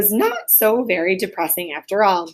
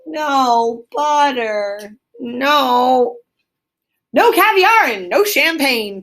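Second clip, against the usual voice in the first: grinning tease; 4 semitones higher, much slower than usual. A narrator's reading. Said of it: "continued the rat, grinning".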